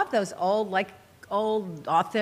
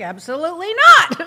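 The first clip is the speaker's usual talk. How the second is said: emphatic no; 6 semitones higher, 10 dB louder than usual. Retold or imitated. Emphatic retort to Randy's question.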